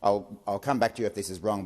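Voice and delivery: usual speaking voice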